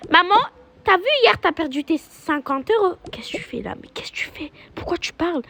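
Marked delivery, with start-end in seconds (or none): 3.1-5.4 hissed whisper. Acted. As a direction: whisper so mom won't hear. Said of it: "whispered scolding".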